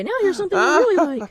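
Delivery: high, loud put-on cartoon voice; mock catchphrase. Joking about flying around the parking lot, high-pitched mock catchphrase. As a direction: pitch it way up, playfully announcing it.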